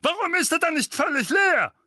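high loud outburst; furious uncle flares up; flaring up. A narrator's reading. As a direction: blow up at him. Vernon continues outburst.

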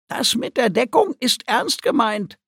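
high-pitched little creature voice; earnest warning in a high creature voice; creature speaking aside. A narrator's reading. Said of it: "hörte sie Guki leise sagen".

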